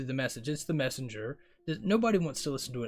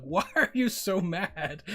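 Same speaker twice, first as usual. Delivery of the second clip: raised, drawn-out mock yell at oneself; mock self-yelling; 5 semitones higher, 4 dB louder, slower than usual. Driver yelling at themself on the road, retold mock yell. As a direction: stretch it out in higher-pitched exasperation, half-yelled. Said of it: "yelling at myself".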